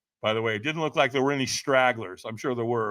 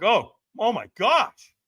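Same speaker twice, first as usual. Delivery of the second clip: higher, slow dawning dismay; retold alarm; 6 semitones higher, slower than usual. Firewalker thinks feet are burnt, retold alarm. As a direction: mimic a sudden worried realisation.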